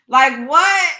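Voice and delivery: loud disbelieving what; shocked. Real, not acted. Reacting loudly in disbelief to the story. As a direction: blurt it out in shock.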